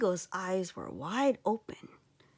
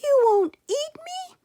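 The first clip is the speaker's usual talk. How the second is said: high, loud disbelieving question; a small boy amazed he is spared; boy asking the eagle, high and loud; astonished boy; 11 dB louder than usual. Acted. Boy surprised.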